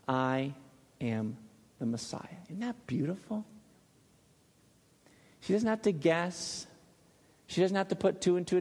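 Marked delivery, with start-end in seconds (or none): 0.0-2.4 a quiet, deliberate reveal; plainly and clearly. Retold or imitated. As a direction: lay out "I", "am", "the Messiah" one word at a time, quietly. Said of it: "he tells her very plainly and clearly".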